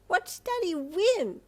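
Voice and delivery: put-on high, slow, loud child; proud little girl. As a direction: announce it like a proud toddler. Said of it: "Komi announced".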